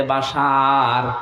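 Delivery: name stretched long on a held note; drawn-out call. Acted. Voice calling the sleeping Bashar in a dream, drawn-out call. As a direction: call out slowly, lengthening the last syllable.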